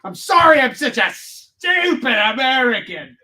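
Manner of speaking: high, slow dopey American voice; mock apology. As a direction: grovel in a dumb voice. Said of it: "I'm gonna tell him that".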